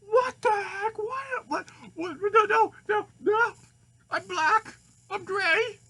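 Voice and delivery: very high, loud shriek of disbelief; high-pitched, full-out freak-out; horrified scream. Acted. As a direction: scream it in acted horror at your own reflection. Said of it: "He screams like".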